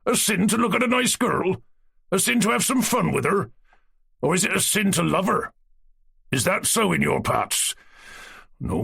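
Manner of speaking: lightly voiced old man holding forth; jovial old man lecturing a cadet; old Cossack persuading. A narrator's reading. Eroshka's persuading speech.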